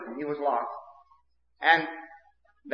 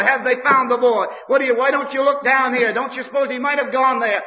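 higher and louder urgent question; anxious asking; 7 semitones higher, 4 dB louder than usual. Retold or imitated. Townsfolk asking each other about the lost boy, anxious and loud. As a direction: ask it like a worried neighbour.

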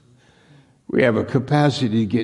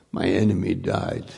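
usual speaking voice

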